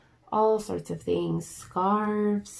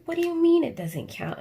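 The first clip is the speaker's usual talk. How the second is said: indignant disbelief; 10 semitones higher, 5 dB louder than usual. Retold or imitated. Retelling argument with husband.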